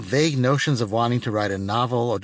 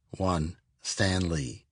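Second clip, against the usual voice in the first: slow, softer deadpan beat; dry slow punchline; 5 semitones lower, 5 dB quieter, much slower than usual. A narrator's reading. Narrator deflating the listener's guess with slow dry deadpan. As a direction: let the answer land slowly and dryly.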